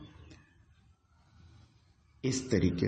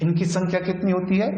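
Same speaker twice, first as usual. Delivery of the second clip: usual speaking voice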